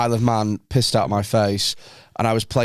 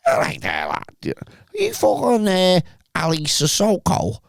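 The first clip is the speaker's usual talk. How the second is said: hammed-up Scouse accent, pitch jumping high and slow at the end; a mock Scouse accent, drawn out; terrible Scouse accent; 7 semitones higher, slower than usual. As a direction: do a bad Liverpool fan spotting a footballer. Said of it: "put a terrible Scouse accent".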